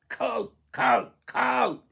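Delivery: very high, loud goblin cries; excited goblins rushing off; goblins crying. Acted. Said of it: "went the goblins".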